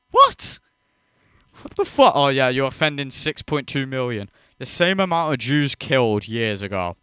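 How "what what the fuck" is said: disbelief. Real, not acted. Reacting in disbelief to clip.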